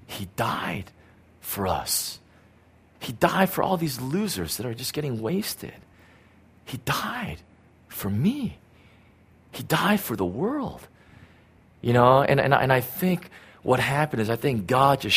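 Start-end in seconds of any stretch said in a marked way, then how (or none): 0.1-10.9 quiet awe. Acted. Intimate sermon climax.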